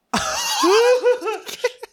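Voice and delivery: high sustained laughter; long laugh. Real, not acted. Recalling the haunted hotel to co-host, laughing. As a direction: let the laugh run on.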